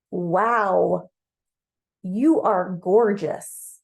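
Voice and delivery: awestruck admiration. Acted. You to Mars on first seeing him, awestruck. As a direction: stretch it out in open admiration.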